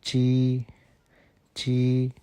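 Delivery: ची said as a light unaspirated chi; tongue flat, short ची; ची, the Chinese j sound. Shown as a demonstration. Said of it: "इसको हम बोलेंगे".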